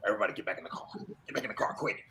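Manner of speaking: hurried half-whisper telling everyone back in the car; hushed urgent order. Retold or imitated. Driver urging escape, hushed.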